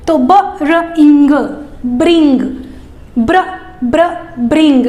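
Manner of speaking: "ब्र" then "इंग", joined into "ब्रिंग"; sound blending. Shown as a demonstration. Teacher showing parents how to blend bring. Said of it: "इस तरह से बोल करके सिखाना है".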